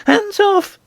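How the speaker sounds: very high, victorious queen; high-pitched gloating command; triumphant cry. A narrator's reading. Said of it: "cried the Queen triumphantly".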